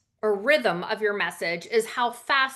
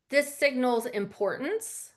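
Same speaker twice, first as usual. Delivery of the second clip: ordinary delivery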